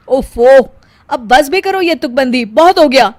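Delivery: loud, fed-up husband; fed-up husband telling his wife to stop; exasperated. Acted. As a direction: stop her rhyming habit, exasperated.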